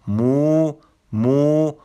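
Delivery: teacher modelling the suffix مو، with a rounded vowel; suffix demo. Shown as a demonstration. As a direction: model مو، twice, long and high. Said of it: "اونی که یو بی نقطه داره".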